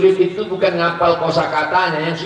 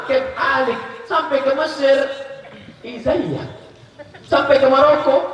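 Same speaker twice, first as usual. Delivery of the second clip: playful; 10 semitones higher than usual. Acted. Listing greetings with mock voices.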